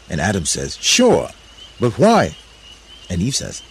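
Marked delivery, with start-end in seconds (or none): 0.8-2.4 loud, raised-pitch reply; puzzled call back. A narrator's reading. Adam answering hidden Eve, puzzled loud call. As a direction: answer loudly, high and puzzled.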